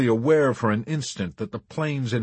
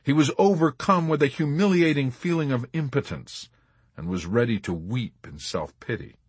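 ordinary delivery, nothing marked

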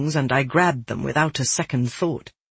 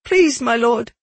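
higher-pitched, pleading appeal; begging; 8 semitones higher, 3 dB louder than usual. A narrator's reading. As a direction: plead with your lord in a raised pitch. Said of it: "I begged".

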